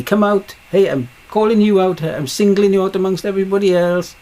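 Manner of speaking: high, loud calling-out; commanding call. Retold or imitated. Voicing God calling you out by name, high loud call. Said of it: "Call your name?".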